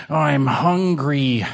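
slow, drawn-out mild complaint; lightly voiced kitten with a rumbling tummy; hungry kitten. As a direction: draw it out like a hungry kid.

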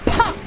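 sudden high pop; short explosive burst on one high word; popping noise. A narrator's reading. Narrator imitates a nest shot from the smokestack, high pop.

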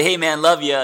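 high, loud, breezy "Hey, man, love ya"; casual bro shout-out. Shown as a demonstration. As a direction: toss it off like a loud bro greeting. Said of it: "you can say".

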